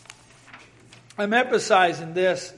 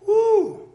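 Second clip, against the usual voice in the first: excited whoop; 13 semitones higher, 6 dB louder than usual. Real, not acted. Whoop after Paul's doxology.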